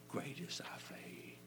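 soft unvoiced whisper, slow; slow whispered praise; hushed awe. Real, not acted. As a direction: whisper it in wonder. Hushed words of the Lord.

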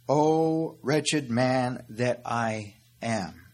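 drawn-out, slow quotation; slow lament. Acted. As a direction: stretch each word slowly like a lament. Quoting Paul's lament.

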